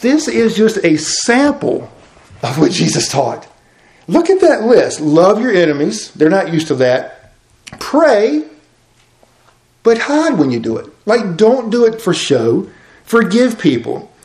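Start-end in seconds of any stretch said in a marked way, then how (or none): 4.1-14.1 fervent preaching. Acted. Preaching list, raised pitch.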